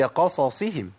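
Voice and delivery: قصصهم slowly with both ص distinct; full vowels. Shown as a demonstration. Teacher correcting the mistake, slow full vowels. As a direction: give each vowel in قصصهم its full time. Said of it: "هي".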